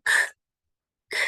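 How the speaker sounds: isolated throaty "k" bursts; K-like sound. Shown as a demonstration. Teacher building the G from "k", K-like. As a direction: pop "k" hard from the back of the throat. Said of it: "they make it sound like a K".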